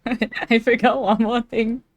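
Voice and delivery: slow words through giggles; helpless giggly delivery; laughing admission. Real, not acted. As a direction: confess it while cracking up. Laughs at her own forgetting.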